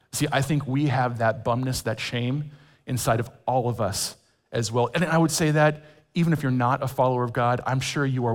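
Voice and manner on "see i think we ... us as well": usual speaking voice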